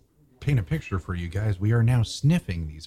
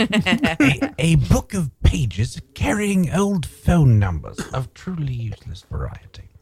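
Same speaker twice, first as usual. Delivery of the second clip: narrator voice; 7 semitones higher, 3 dB louder, slower than usual. Pretend. Mock documentary explanation.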